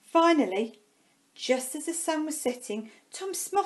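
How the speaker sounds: ordinary delivery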